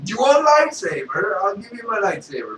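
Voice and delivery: shouted Vader offer; Darth Vader. Acted.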